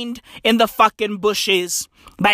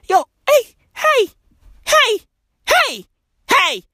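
high repeated hey hey, louder; calling out; 12 semitones higher, 4 dB louder than usual. Real, not acted. A comic calling for attention with high, louder heys. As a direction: shout hey over and over to get attention.

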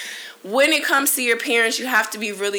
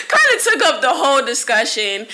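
laughing through the words, louder; amused at herself, chuckling; real laugh while telling it; 5 semitones higher, 6 dB louder than usual. Real, not acted. Laughing at own question.

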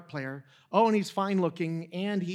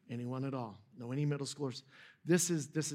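soft repeat; 4 semitones lower, 6 dB quieter than usual. Shown as a demonstration. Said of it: "Does anybody know any other middle schoolers".

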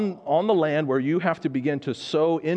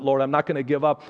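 ordinary delivery

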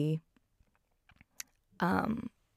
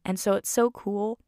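usual speaking voice

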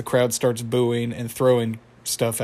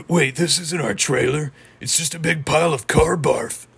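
put-on cartoon voice, a little raised and louder; acted cartoon character; 4 semitones higher, 4 dB louder than usual. Fan retelling Muscle Man's find of car barf, acted cartoon voice. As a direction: play the dismayed cartoon character.

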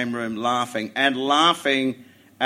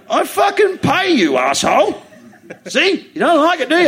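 much higher, louder, laughing shout; hammed-up boss yelling; mock furious shout; 8 dB louder than usual. Retold boss shouting at his cleaner, hammed-up.

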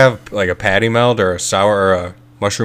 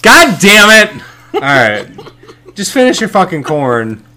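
exasperated shout; 8 semitones higher, 4 dB louder than usual. Real, not acted. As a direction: blurt it out, fed up. Exasperated outburst at corn eater.

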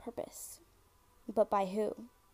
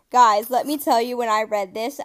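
much louder, bursting with excitement; excited outburst; 14 dB louder than usual. Real, not acted. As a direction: grab the listeners' attention loudly. Hyped about the listener story.

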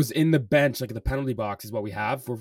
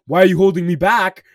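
angry protest; 6 semitones higher, 10 dB louder than usual. Retold or imitated. Angry player quoted being restrained.

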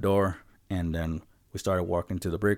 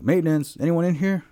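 high, louder call into the room; 10 semitones higher, 6 dB louder than usual. Retold or imitated. Checking the restroom before cleaning, raised call. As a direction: call out to check if anyone's inside.

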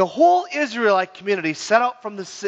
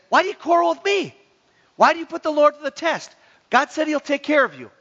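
higher-pitched protesting Moses; exasperated Moses; 7 semitones higher than usual. Acted. Moses answering the thirsty, quarreling Israelites, exasperated and high. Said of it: "Then Moses says".

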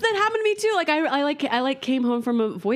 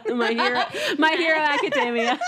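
laughing; 6 semitones higher than usual. Real, not acted. Laughing at title.

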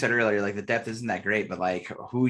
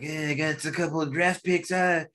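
lightly voiced GM in a vague put-on accent, higher; a hint of a put-on accent for the general manager; unnamed put-on accent; 5 semitones higher than usual. Doing the GM in a light put-on accent, offering picks to the Titans. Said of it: "I don't know why I'm making that accent".

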